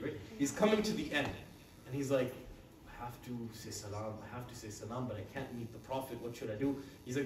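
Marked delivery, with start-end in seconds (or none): 2.9-6.8 worried inner voice. Acted. Companion's worried inner thoughts.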